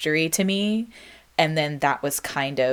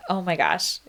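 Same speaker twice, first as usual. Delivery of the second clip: ordinary talk, nothing marked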